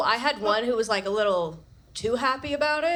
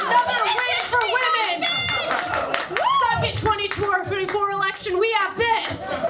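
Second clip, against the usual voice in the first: high, loud, slowed gloating cheer; triumphant cheer; 11 semitones higher, 3 dB louder than usual. Real, not acted. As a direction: crow it like a victory chant. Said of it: "triumphant cheer".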